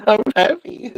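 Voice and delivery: high giggling "I'm happy"; creepy Joker impression. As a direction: mimic a grinning tweaker. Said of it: "he's like".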